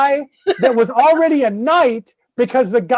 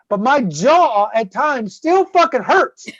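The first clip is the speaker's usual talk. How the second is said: ordinary delivery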